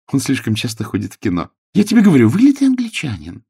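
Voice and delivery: lady's voice climbing high and loud; insistent lady. A narrator's reading. Lady assuring Clara about the visitor, insistent. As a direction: start even, then insist in a high pitch.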